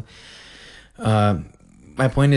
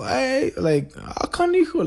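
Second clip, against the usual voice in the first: high mock reaction behind a raised fist; amused exclamation; 13 semitones higher, slower than usual. Showing how Hispanics cover their mouth, high exclamation. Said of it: "raise it up to their mouth".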